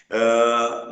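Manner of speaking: normal talking voice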